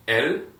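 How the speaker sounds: "él" stressed and loud, clear open e; model pronunciation. Shown as a demonstration. Teacher modeling "él" for repetition. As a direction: say "él" crisply with the accent on the E. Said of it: "I want you to repeat after me".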